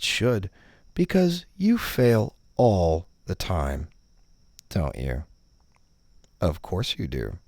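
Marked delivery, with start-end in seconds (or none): none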